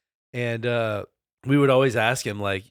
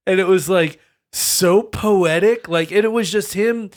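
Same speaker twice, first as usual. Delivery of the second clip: delighted; 8 semitones higher, 9 dB louder than usual. Real, not acted. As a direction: gush over how poetic it was. Marvelling at Byron's phrase.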